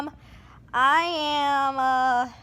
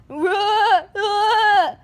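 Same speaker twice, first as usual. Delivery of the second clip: loud higher roars; playful roar; 6 semitones higher, 5 dB louder than usual. Pretend. Child roaring as a bird, loud high roar. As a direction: roar like a beast.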